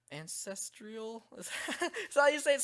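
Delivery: questioning, breaking into high laughter; amused and unsure, rising into a laugh; laughing doubt. Real, not acted. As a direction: query the word, then crack up. Laughs over doubtful pronunciation.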